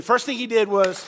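call and response. Shown as a demonstration. Preacher leading the crowd in a loud call and response. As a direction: chant it along with the congregation. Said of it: "Do it with me".

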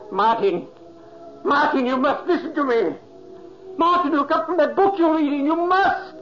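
high, loud pleading calls; desperate shouting. Acted. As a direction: ghost begging brother to look up, shouting desperately. Ghost shouting to unhearing brother.

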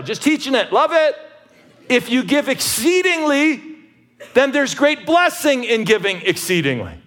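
loud, high, driving preacher's delivery; preaching at full force. Acted. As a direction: hammer the point home to the congregation. Pastor preaches on sowing sparingly.

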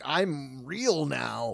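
slow, lightly creepy Freddy line. Retold or imitated. Quoting the film to friends, slow lightly creepy Freddy. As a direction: drag it out like a slasher. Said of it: "Freddy's like".

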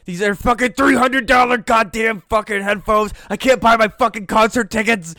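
angry rant. Real, not acted. To Jeff, raging over broken headphones: furious rant. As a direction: vent it full blast.

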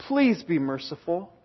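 subdued, humble pleading; hushed plea. Retold or imitated. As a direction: drop to a quiet beg for mercy. Prayer drops after the outburst.

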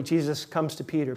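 ordinary talk

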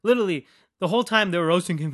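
normal talking voice

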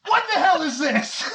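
loud, high mock yell; loud retold yell. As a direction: bark it out loudly. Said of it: "really loudly goes".

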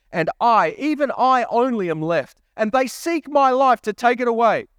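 high, pleading complaint; anguished lament. Acted. Elijah's complaint read dramatically.

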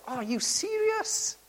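high-pitched disbelief; incredulous disbelief. Retold or imitated. Disciples' incredulous reaction to Jesus.

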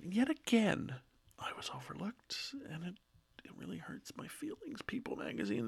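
soft, breathy, wounded whisper; mock-hurt whisper. Complaining to People magazine about being overlooked, mock-hurt whisper. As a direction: play the snubbed victim, barely voiced.

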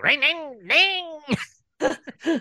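very high, ringing motor whine; tiny high-pitched machine noise; imitated electric slicer. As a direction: mimic a little slicer buzzing. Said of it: "He pulls out the little bread slicer".

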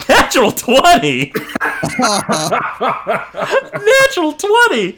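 shouting through laughter; laughing. Real, not acted. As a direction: cackle out the roll result. GM laughing at a natural twenty.

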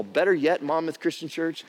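ordinary delivery

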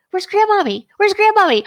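put-on high little-kid voice, pleading; child's voice. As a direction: imitate a toddler asking. Toddler voiced in a story.

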